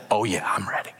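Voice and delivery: breathy laughing whisper; whispered groom. Retold or imitated.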